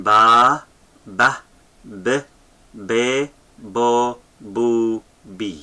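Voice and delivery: each syllable alone, from "ba" to "bi"; teacher's slow syllable chant; vowel drill. Shown as a demonstration. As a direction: recite "ba, ba, b, be, bo, bu, bi" one at a time with pauses. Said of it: "So again".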